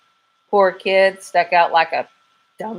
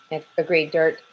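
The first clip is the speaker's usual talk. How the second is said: ordinary delivery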